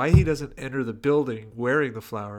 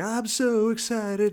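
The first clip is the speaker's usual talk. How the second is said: high, slow squeal of excitement; high mock Jessie; 11 semitones higher, 4 dB louder, slower than usual. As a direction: squeak it out slowly like a hammy teen. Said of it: "she's like".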